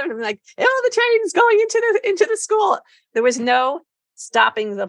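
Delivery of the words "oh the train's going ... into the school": put-on high sing-song kid play voice; playful train voice. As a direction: chirp it to a toddler. Playing trains with the kids.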